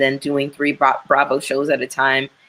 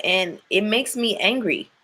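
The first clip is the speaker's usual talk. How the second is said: ordinary talk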